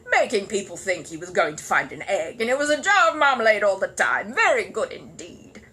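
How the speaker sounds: condescend to the bear; gruff know-all neighbour. Acted. Grumpy neighbour praising the bear grudgingly, pompous.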